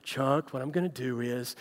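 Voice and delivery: gentle doctor. Retold or imitated. Doctor gently explaining surgery to patient, softly. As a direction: ease into it like a kind surgeon. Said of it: "just so lovingly".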